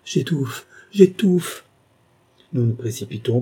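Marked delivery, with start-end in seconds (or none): 0.0-1.6 choking cry rising higher and louder; desperate, strangled exclamation growing stronger; hoarse cry. A narrator's reading. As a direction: cry out as if suffocating. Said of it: "pousse un cri rauque".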